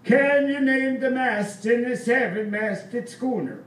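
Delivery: put-on stern old Swedish seaman testing a kid; stern old sailor. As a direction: quiz the boy like a gruff old Swede. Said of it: "quite sternly".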